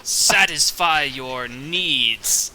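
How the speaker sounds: slow, drawn-out suggestive offer; sly. Acted. Gra offers what the lady's guards could not, sly. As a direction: lean slyly on the innuendo. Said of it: "he tries his best slyly".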